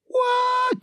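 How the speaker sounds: high squeaky shocked what; stunned what. Retold or imitated. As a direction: squeak it out in disbelief. Stunned at Cosby on phone.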